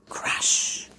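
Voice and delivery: ordinary delivery, nothing marked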